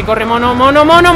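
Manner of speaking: urging that climbs into a high shout; building high, loud gamer panic; panicked urging. Real, not acted. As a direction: panic rising as you call to flee. Urging character past an enemy.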